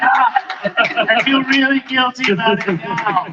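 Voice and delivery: high, slow hammed-up soliloquy; mock talking to oneself. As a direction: confess aloud to nobody, drawn out. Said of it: "Yelling to yourself".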